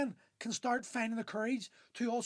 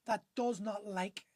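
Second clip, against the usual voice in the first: ordinary talk, nothing marked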